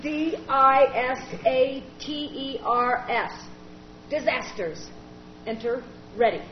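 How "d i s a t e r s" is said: slow letter-by-letter spelling. Acted. Jamie typing at the computer, slow spelling. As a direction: spell it out slowly.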